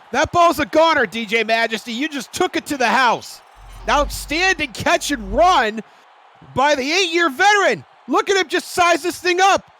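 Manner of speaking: loud, high hyped commentary; excited. Real, not acted. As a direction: gush over the play, loudly. Color commentator hyped replay.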